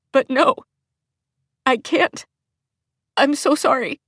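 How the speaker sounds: high, tearful and pleading; tearful apology. Acted.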